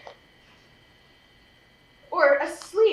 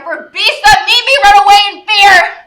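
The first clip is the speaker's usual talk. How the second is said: much louder and higher, despairing; anguished outcry; 4 semitones higher, 11 dB louder than usual. Acted. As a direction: wail it out in despair at her own ugliness. Helena laments loudly in self-pity.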